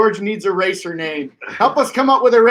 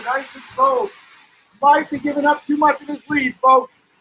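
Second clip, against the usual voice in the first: live play-by-play; 7 semitones higher than usual. Acted. Live race commentary.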